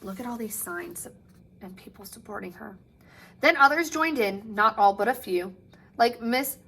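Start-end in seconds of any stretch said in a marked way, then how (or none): 0.0-2.8 soft, quiet aside; hushed aside. Acted. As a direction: point it out softly to the kids. Reader's soft aside to kids.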